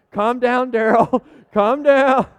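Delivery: laughing, mock soothing father; laughing retelling. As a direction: crack up while repeating the dad. Dad retold, laughing punchline.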